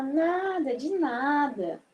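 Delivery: slow, drawn-out de nada; slow you're welcome. Shown as a demonstration. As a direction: stretch it kindly. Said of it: "a pessoa fala". (de nada bem devagar)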